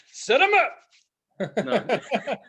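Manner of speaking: high, slow laugh through the words. Real, not acted. Laughing at vaccine joke.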